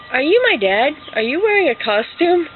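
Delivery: put-on high-pitched questioning child voice; suspicious little kid. Little girl suspects Santa is her dad, high questioning. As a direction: ask it high like a puzzled kid. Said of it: "So I'm like".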